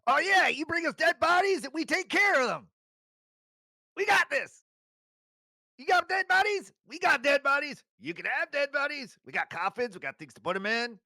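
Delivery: high, shouted sales pitch; loud salesman. Acted. Shopkeeper pitches dead-body services to the adventurers, high and shouting. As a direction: sell it hard like a carnival barker.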